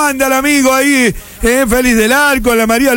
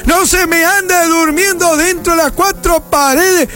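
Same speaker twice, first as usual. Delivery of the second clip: announcer greeting the listeners on Cadena Melodía; radio announcer; 5 semitones higher than usual. Acted. Radio host's morning opening.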